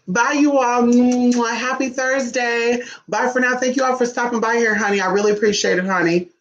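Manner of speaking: high, lilting farewell; sing-song sign-off. Real, not acted. Host thanking viewers for stopping by, high sing-song goodbye.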